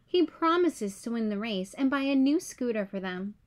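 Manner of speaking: ordinary delivery, nothing marked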